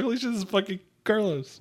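high, startled exclamation at a lookalike; mock amazed. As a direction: act out your own shock. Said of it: "I was like".

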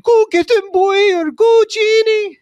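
put-on high, loud Scottish-accented screech; loud high Scottish cheer from the crowd; Scottish woman screaming. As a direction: shriek it like an old Scottish lady cheering. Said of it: "she's screaming in the Scottish accent".

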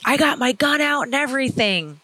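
mock-irate biker voice; retold angry threat. Retold biker warning her off.

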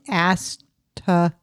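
ASTERISK broken slowly as "as... tuh... rik"; slow, separated syllables in asterisk. Shown as a demonstration. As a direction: sound out asterisk slowly with gaps, ending "rick". Sounding the word out in syllables.

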